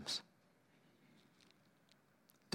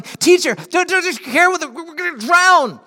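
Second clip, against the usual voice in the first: high, loud, panicked cry for help; panicked shout; 14 semitones higher, 9 dB louder than usual. Acted. Disciples waking Jesus in the storm, panicked shout. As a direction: shout it like men about to drown. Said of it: "the disciples woke him up shouting".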